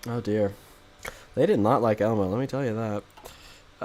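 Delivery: ordinary delivery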